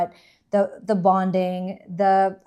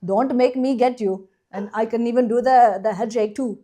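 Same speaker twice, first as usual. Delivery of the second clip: hammed-up Indian accent, raised pitch; imitation Indian accent; 2 semitones higher than usual. Shown as a demonstration. Co-host answering the challenge to do an accent, mock Indian accent. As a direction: put on a rough, comic Indian accent. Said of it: "that good of an Indian accent".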